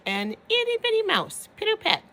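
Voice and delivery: high, light itty-bitty voice; tiny mouse voice. Acted. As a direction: make it sound small and dainty.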